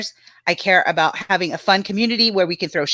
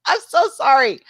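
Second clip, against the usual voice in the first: giggling apology; 9 semitones higher than usual. Real, not acted. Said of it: "I was laughing my ass off".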